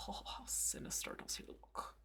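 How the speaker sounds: soft breathy whisper. Real, not acted. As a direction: hush it to yourself. Admiring the painted figure.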